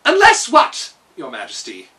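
eager question to the king; Kaliko. A narrator's reading. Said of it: "inquired Kaliko".

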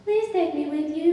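put-on high, loud child's plea; pleading child. A narrator's reading. Child begging stranger to take it along, pleading. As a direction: beg loudly in a high child voice. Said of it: "The child looked at me pleadingly".

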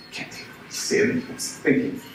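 hushed inner voice, unvoiced; a private bitter thought, barely breathed; whispered thought. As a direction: whisper it to yourself.

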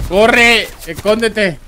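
high, loud warning cries; urgent shout. Real, not acted. Spectator telling a player to hide, urgent shout. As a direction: yell it like a desperate warning.

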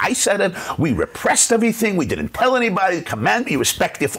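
put-on high, indignant parent defending the old ways; defensive parent. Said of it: "And you think".

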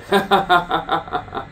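high burst of laughter. Real, not acted. Speaker laughing at his own sweary outburst, high laugh. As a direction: break into a high, quick laugh.

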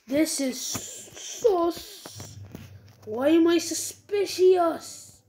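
very slow, drawn-out words; slow motion. Pretend. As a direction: stretch every word out slowly. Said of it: "I'm gonna stop talking in slow motion".

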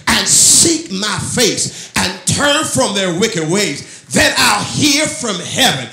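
high, very loud scripture proclamation; full-force preaching. Real, not acted. Preacher declaring God's promise to the church, full-force preaching. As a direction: thunder the verse out slowly, phrase by phrase.

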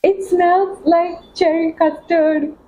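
high, slow, awestruck exclamation; thrilled, stretched-out high voice; amazed delight. Real, not acted. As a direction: draw the words out high in happy disbelief. Amazed reaction after sniffing.

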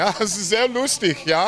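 put-on high, loud joking Bavarian accent; hammed-up Bavarian German accent. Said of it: "talk in a Bavarian accent".

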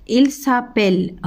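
slow model phrase. Shown as a demonstration. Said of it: "teacher models French phrase".

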